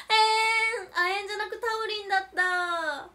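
hammed-up whimper over a mistake; mock crying wail. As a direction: wail like a let-down child.

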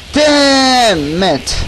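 drawn-out high curse; frustrated outburst. Real, not acted. Gamer reacting to the game, drawn-out frustrated curse. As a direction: stretch out the curse in exasperation.

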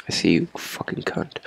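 hushed unvoiced threat; stalking an enemy, whispered. Real, not acted. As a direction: hiss it under your breath. Muttering at enemy.

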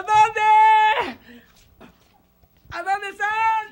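high, loud, urgent question; frantic searching shout. Acted. Hero asking children where ghosts are, frantic shout. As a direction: cry out, hunting everywhere.

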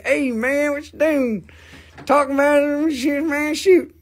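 high-pitched heckling guy in the background. Acted. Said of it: "There is a guy in the background. He is saying".

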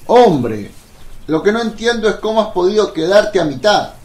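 loud, high-pitched incredulity; exasperated disbelief. Acted. Apostle cannot understand why Judas stopped halfway, exasperated disbelief. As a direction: protest loudly, baffled.

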